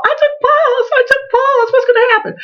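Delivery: very high, frantic plea; retold high panic; panicked. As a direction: squeal it in a panic. Panicking to people in hall.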